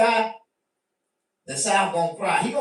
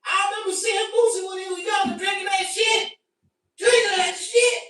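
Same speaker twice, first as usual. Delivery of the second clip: hyped shout; 12 semitones higher than usual. Retold or imitated. Mocking recollection of young [name].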